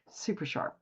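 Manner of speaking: breathy whisper. Acted. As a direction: whisper it softly. Repeating a phrase for emphasis.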